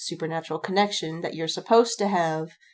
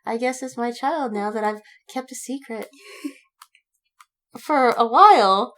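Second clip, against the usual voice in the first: a lightly voiced casual dumbass, higher; casual shrug; 5 semitones higher, 5 dB louder than usual. Voicing the clueless vampire dad, casual and amused. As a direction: toss it off with a laugh like it's no big deal. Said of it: "And he's like".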